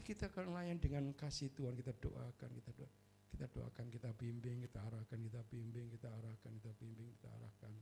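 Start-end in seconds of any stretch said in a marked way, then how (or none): 1.8-7.7 fading hush. Real, not acted. Pastor fading into hushed repetition.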